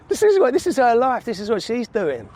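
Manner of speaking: high-pitched, marvelling disbelief; retold amazement. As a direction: re-enact being amazed by someone's life. Retells amazement at cousin's life.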